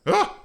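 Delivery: sudden high shriek of alarm; startled shriek. Real, not acted. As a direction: yelp as if something went wrong. Miner digs into wall.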